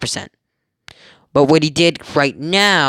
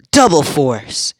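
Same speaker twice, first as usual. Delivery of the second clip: battle cry; slower than usual. Acted. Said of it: "Deku yells out".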